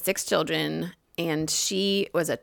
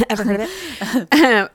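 laughing ad pitch; 5 semitones higher, 5 dB louder, slower than usual. Shown as a demonstration. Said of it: "that I'm shouting out right now".

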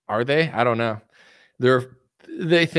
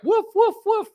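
squeaky high yappy woofs; mock dog barks. The public barking back at elites, yappy high woofs. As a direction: bark like an obedient little dog. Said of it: "And you're like".

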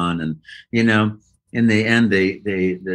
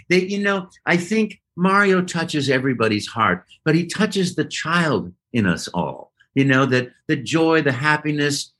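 usual speaking voice

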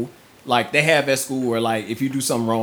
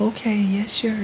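put-on child's voice; 10 semitones higher than usual. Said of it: "daughter answering her dad".

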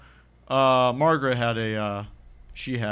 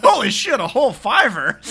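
very high, loud gasp; high loud mock awe; hammed-up amazement; 11 semitones higher, 10 dB louder than usual. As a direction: squeal like it's a fortune. Spoof rental clerk.

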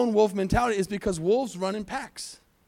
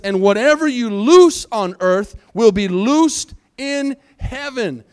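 slow, loud proclamation of a verse; emphatic pulpit Bible reading; scripture reading; 4 semitones higher, 7 dB louder, slower than usual. Acted. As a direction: declare the verse forcefully, drawing it out. Reads scripture aloud.

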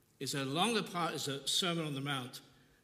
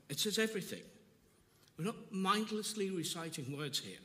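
usual speaking voice

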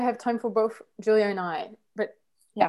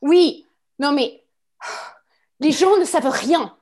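higher, louder indignant French; French anger; 4 semitones higher, 5 dB louder than usual. Acted. French person tells others they know nothing, sharp anger. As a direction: scold them sharply in French. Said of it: "go into this French anger".

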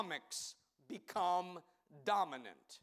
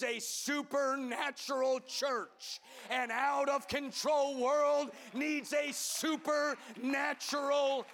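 louder, higher rhythmic preacher's drive; full-force preaching; 4 semitones higher, 4 dB louder than usual. Acted. Pastor rallying the Sunday congregation, loud preaching shout. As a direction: build the congregation up, hammering each line.

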